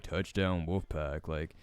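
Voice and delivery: low, slow, unexcited touchdown call; imitating a bored sports call; bored commentator. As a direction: drag it out low like a bored announcer. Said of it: "he said that shit so boring".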